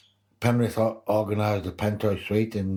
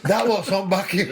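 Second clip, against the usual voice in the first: high, loud retort; imitated holler; 12 semitones higher, 5 dB louder than usual. Teammate answering at half time, high imitated holler. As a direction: call it out high, on the edge of laughing. Retelling teammate's comeback amid laughter.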